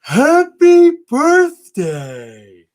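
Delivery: high, slow, drawn-out snowman voice; sing-song cartoon snowman; Frosty the Snowman voice. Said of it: "Can you do your best Frosty the Snowman impression?".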